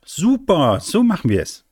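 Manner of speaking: high, loud, smiling agreement; delighted call. A narrator's reading. Mika agrees to Ben's plan, calling out delightedly. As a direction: cry out happily, pitched right up. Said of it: "Mika lächelte und rief".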